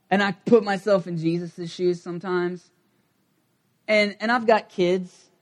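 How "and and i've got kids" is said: ordinary delivery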